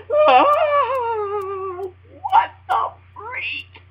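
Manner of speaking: high, loud shocked cry; what the freak, squealed in mock shock. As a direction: squeal the disbelief. Shocked by caller's rant.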